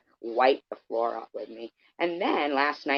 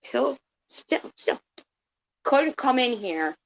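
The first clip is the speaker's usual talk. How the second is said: mock voice; 10 semitones higher, slower than usual. Mocking a commenter's voice.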